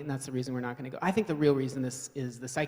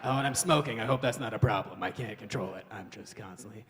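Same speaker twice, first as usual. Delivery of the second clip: lower, raspy-free weary single mom pitch on a date; Mother Earth dating; 4 semitones lower than usual. Acted. Mother Earth on the dating scene, weary and lower. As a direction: play a worn-out mom on a date. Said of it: "on the dating scene like".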